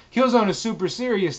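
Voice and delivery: usual speaking voice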